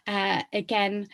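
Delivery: normal talking voice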